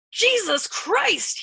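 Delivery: high, loud, drawn-out scream; tortured amazed scream. A narrator's reading. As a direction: scream it slowly in horrified disbelief. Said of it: "he screamed at Arfi in tortured amazement".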